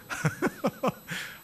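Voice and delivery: breathy, quiet burst of chuckles; laughter. Real, not acted. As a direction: laugh at your own teasing joke. Laughs at own joke about congregation. (숨죽여 킥킥 웃는 소리)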